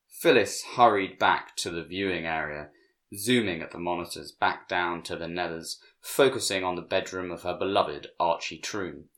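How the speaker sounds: usual speaking voice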